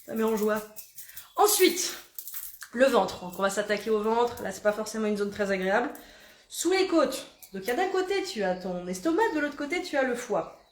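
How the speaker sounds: ordinary delivery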